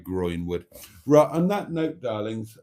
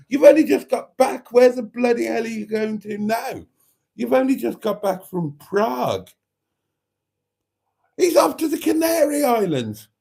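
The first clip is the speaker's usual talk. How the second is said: much higher and louder, a mock nagging spouse; exasperated partner; 12 semitones higher, 5 dB louder than usual. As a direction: play the fed-up partner at home, shrill with disbelief.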